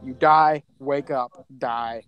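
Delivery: ordinary talk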